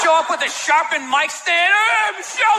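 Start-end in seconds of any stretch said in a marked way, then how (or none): 1.7-2.6 high, hollered call-out; mock holler. Comedian with mic stand confronting captors, high mock holler. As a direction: holler it far above your usual pitch.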